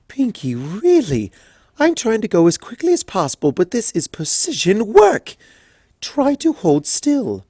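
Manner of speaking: a fussy seamstress holding her temper; Rarity, prim and fussy. Acted. Rarity scolding Pinkie at the fitting, prim and fussy. Rarity voiced, high and refined.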